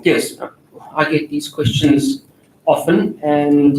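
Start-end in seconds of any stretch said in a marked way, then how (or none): none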